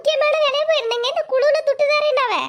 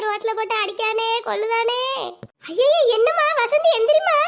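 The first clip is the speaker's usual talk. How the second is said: ordinary talk, nothing marked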